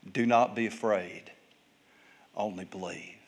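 soft, low, with long pauses; calm a frightened father, sinking almost to a hush; quiet, measured comfort; gentle reassurance. Acted.

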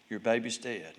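low, very soft and grave; subdued, sombre announcement; grave bad news. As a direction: break the news in a hush. Imagined death news, after long pauses.

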